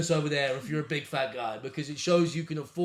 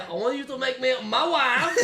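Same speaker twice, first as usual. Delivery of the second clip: high, hammed-up Ukrainian accent; mock Ukrainian accent; 8 semitones higher than usual. Friend mimicking the forward Ukrainian woman, mock Ukrainian accent. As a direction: tease with an exaggerated Ukrainian accent. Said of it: "Did she say".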